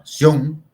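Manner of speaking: sion said loud and isolated; loud, emphatic sion; stressed syllable alone. Shown as a demonstration. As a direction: punch out sion as the strong syllable. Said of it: "Lleva la fuerza en la última sílaba".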